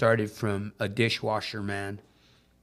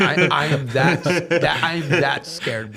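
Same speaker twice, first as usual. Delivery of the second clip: laughing and louder; 3 semitones higher, 8 dB louder than usual. Real, not acted. Joking with the host about being scared, laughing. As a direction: laugh through the confession.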